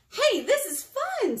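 high, loud, excited exclamation; delighted bird. A narrator's reading. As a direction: grumpiness gone, thrilled discovery. Bird suddenly cheers up.

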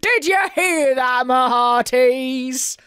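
loud pirate shout, starting high and slowing down; Captain Blackheart roaring. A narrator's reading. Pirate captain calls to his crew, roaring. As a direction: bellow it like a pirate captain, stretching the last words. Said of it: "roared Captain Blackheart".

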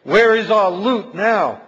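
a touch of a tormented thief; lost thief crying out. A narrator's reading. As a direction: wail it like a damned thief. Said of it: "for they say".

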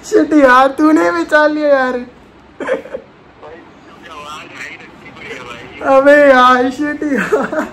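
loud, laughing exclamations; laughing loudly. Real, not acted. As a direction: wheeze through laughter, barely able to talk. Reacting after prank call.

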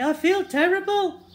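high, loud strained mock whisper; hoarse stage whisper. Boy feigning illness to the nurse, loud strained whisper. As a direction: strain it out as a sick child. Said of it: "whispered Henry".

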